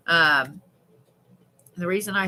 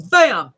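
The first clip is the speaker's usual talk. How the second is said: loud, high, falling "bam"; explosive bam; sudden impact exclamation; 5 semitones higher, 11 dB louder than usual. Retold or imitated. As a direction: punch it out like a sudden hit. Imitating a sudden hit.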